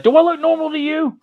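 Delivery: high, squeaky mock protest; imitating indignant Joe. Acting out Joe confronting police.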